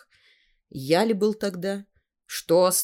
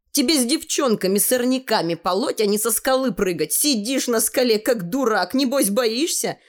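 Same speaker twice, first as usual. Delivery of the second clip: put-on boys' taunt; 6 semitones higher than usual. Boys taunting Seryoga.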